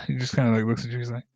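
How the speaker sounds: ordinary talk, nothing marked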